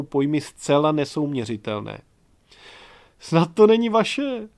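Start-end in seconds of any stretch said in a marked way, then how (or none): 3.2-4.5 higher, louder, slow gleeful delight; Čmelda rejoicing over the baby bundle, gleeful. A narrator's reading. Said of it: "zaradoval se Čmelda".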